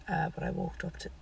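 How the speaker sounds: ordinary talk, nothing marked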